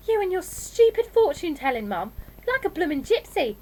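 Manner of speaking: put-on high, loud teenage scorn; exasperated daughter having a go; scornful. A narrator's reading. Daughter scorns mum's fortune-telling.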